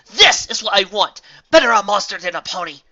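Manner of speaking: high, loud pleading shout; desperate shout. A narrator's reading. Astor shouts at Celestia, refusing to be sent away. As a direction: shout it, begging to stay.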